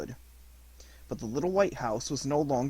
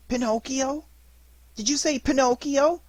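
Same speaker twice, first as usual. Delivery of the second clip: put-on high, pleading boy's voice full of grief; a lost little puppet begging his dead fairy to come back; weeping puppet boy; 12 semitones higher, 5 dB louder than usual. Pinocchio surprised by his name.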